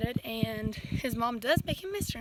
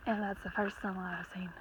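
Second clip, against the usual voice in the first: hushed whisper at the sunrise; soft awe, barely voiced; a soft, quiet whisper of wonder; 4 semitones lower, 8 dB quieter than usual. Real, not acted. First sunrise on the trail.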